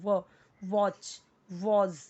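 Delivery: व, वॉच, वॉज, building up the sound of was step by step. Shown as a demonstration. Teacher sounding out was for students. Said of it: "हम वो पढ़ते हैं".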